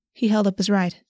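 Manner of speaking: ordinary delivery, nothing marked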